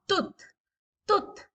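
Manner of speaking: quick, high, short-vowel "Tooth"; short vowel demo. Shown as a demonstration. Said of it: "हम ऐसा नहीं बोलेंगे".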